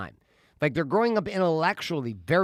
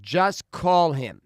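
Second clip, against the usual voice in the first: slow, louder, each word weighted; firm instruction; 3 semitones higher, 6 dB louder, much slower than usual. Retold or imitated. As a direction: spell it out slowly to a reluctant kid. Dad's firm instruction, retold.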